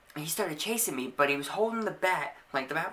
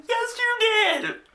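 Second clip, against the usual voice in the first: very high, loud, drawn-out wail; mock tearful protest; 9 dB louder, much slower than usual. Punched kid contradicting the girl before the teacher, mock tearful wail. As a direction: wail it back, hammed-up, as if fighting tears. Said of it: "fighting tears on".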